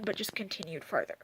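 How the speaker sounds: normal talking voice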